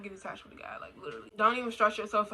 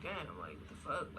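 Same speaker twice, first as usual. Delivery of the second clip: low, soft aside of disbelief; muttered disbelief; 6 semitones lower, 9 dB quieter than usual. Retold or imitated. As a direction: drop it low, baffled to yourself. Retold baffled inner reaction.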